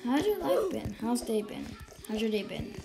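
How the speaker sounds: ordinary talk, nothing marked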